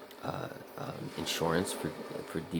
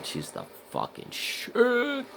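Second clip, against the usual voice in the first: squealed high and slow, dragged out; gushing praise; 5 dB louder, much slower than usual. Real, not acted. Fan gushing over an author, squealing high and slow. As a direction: gush like a starstruck fan.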